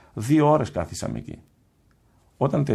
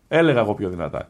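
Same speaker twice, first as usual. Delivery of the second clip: ordinary delivery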